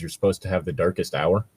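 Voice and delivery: normal talking voice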